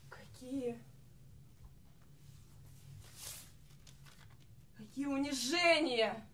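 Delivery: soft, faint lament with long pauses; wounded despair. Acted. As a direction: sigh it out quietly like a dying diva. Singer acts wounded, dying despair.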